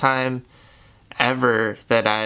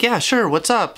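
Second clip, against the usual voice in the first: high, breezy put-on cheer; fake happy; 10 semitones higher than usual. Answering the boss while hiding depression, fake happy. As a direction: fake being happy, pitch way up. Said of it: "pretending to be happy".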